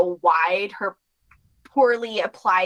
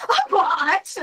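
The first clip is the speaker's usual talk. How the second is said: high, startled yelp; high startled squeak; shocked Celia; 10 semitones higher, 3 dB louder than usual. Acted. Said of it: "as Celia goes".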